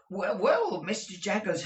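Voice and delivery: high, stuffy voice of a man with a cold; hesitant client with a heavy cold. A narrator's reading. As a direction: sniffle it out. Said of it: "voice of a sufferer from a constitutional cold".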